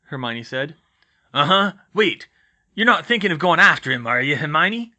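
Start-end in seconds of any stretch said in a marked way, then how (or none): 1.3-4.9 loud, higher rustic voice; gruff gamekeeper, anxious warning; Hagrid, suddenly worried. Acted. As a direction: challenge her with alarm.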